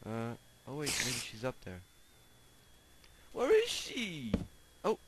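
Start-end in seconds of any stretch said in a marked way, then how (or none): 3.3-5.0 high, slow call of surprise; surprised call. Real, not acted. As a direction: call out, startled and searching. Searching cave for spider.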